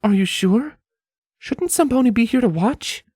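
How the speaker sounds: high worried voice; Scarlet, doubtful. A narrator's reading. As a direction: question him anxiously.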